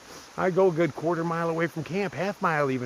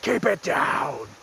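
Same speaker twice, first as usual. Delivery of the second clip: yell out; 5 semitones higher, slower than usual. Retold or imitated. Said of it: "I yell out".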